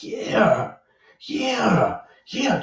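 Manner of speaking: high, dazed agreeing; a spellbound murmur of yeahs; high drawn yeahs in a daze; entranced yeahs. Retold or imitated. Transfixed by the interview.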